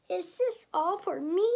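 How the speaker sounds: high, cooing question; touched diva. A narrator's reading. Miss Piggy asking about her gift, cooing. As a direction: lift it up in delighted wonder.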